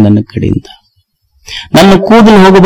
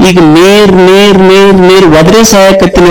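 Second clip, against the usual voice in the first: higher, feeble calls for water, repeating ನೀರ್; pleading old man; 4 semitones higher than usual. Retold or imitated. Old man calls in vain for water, mock plea. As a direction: call ನೀರ್ over and over like a neglected old man.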